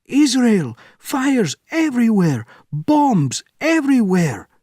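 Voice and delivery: high, loud, frantic; panicked screaming. Acted. Officer on the phone, screaming in panic. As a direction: shout the news in terror.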